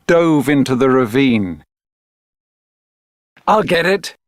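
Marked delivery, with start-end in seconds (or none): 3.5-4.2 put-on high, excited shout of a boy; a boy's eager cry; shouted call. A narrator's reading. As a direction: call out eagerly, lightly voiced. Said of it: "shouted Javier".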